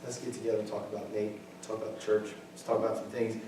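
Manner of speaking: whisper-plotting like church gossips, played lightly; hushed gossip. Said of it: "a group of y'all are like".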